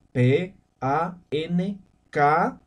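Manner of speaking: deletrea "P A N K" letra por letra, muy despacio; deletreo lento. Shown as a demonstration. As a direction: separa cada letra de "P A N K" con pausas. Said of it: "En Aymara se dice". (deletreando la palabra para los alumnos, muy lento)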